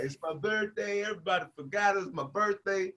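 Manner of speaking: hammed-up sorry-for-himself voice; mock tearful plea. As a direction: whine it pitifully, holding back tears. Pleading tearfully to the cop.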